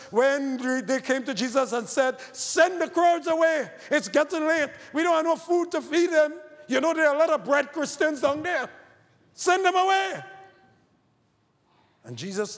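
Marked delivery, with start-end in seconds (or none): 2.3-10.2 high, loud, pleading to send them off; raised, fretful demand; urgent disciples. Acted. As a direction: voice the anxious disciples begging Jesus. Disciples urging Jesus to send crowds.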